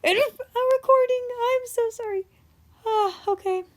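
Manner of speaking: high voice around a sneeze; sneezing. Real, not acted. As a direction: talk through an oncoming sneeze. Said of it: "I'm gonna sneeze".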